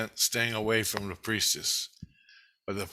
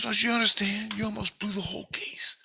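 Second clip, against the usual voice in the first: put-on higher girl's voice, scolding; scolding daughter; 10 semitones higher than usual. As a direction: voice the daughter, higher, lecturing him. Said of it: "And she goes".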